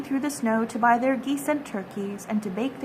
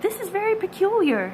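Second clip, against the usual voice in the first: a hint of a higher, puzzled old tailor; lightly voiced old tailor; 9 semitones higher than usual. A narrator's reading. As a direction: read it as the tailor, mildly puzzled. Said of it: "said the Tailor of Gloucester".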